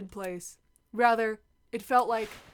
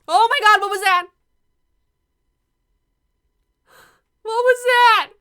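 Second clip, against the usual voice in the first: high, loud startled outburst; panicked alarm; 11 semitones higher, 12 dB louder than usual. Acted. Frightened student reacts to something in the dark, panicked alarm. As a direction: jump with fright, pitch and volume shooting up.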